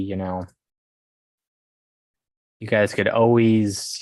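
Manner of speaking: usual speaking voice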